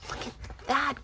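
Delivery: plain reading voice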